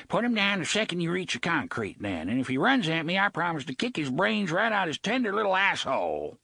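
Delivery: pirate mocking the boy's pet; Gasher threatening. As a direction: spit out the threat with relish.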